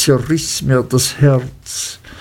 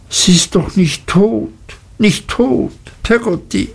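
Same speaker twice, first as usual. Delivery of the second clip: put-on much higher, frightened child's voice; fearful pleading; 8 semitones higher than usual. A narrator's reading. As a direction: plead in dread, pitch climbing high. Child fears his mother is dead.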